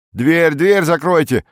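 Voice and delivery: high, loud, stretched call; annoyed shout. A narrator's reading. Cinema audience member to intruder, shouting. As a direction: yell at them to shut it.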